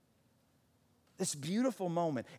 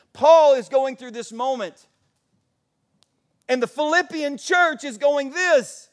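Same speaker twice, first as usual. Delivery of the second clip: loud, raised preacher's voice; impassioned preaching; 8 semitones higher, 12 dB louder than usual. Real, not acted. Preacher pressing the church's plight on the congregation, impassioned. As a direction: preach it out forcefully.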